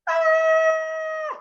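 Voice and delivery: high shouted ah; retold puppet fright scream; mock scream. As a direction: yelp as the page turns. Retelling the scream.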